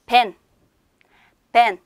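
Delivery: 벤 said as one clear, isolated syllable; teacher's careful 벤, set apart by pauses; pronunciation model. Shown as a demonstration. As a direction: model Turkish 벤 as "ben" for learners. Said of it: "이렇게 읽어주시면 돼요".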